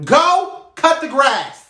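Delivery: slow, high, loud command; stern yell. Acted. Stepmother ordering stepson to do yard work, stern yell. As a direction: yell each word out slowly. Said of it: "Was that your stern voice".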